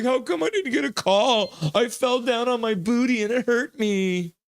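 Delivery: high, whiny complaining voice; whiny Marner mock. Mocking Marner complaining to the ref, a high whine. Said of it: "Marner's talking to the ref like".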